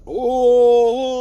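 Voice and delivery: loud high rapturous "Oh"; ecstatic gasp. Retold or imitated. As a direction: let out a loud, high burst of pleasure. Climax reaction, loud high.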